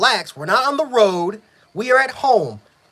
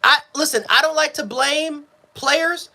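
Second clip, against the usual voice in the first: ordinary talk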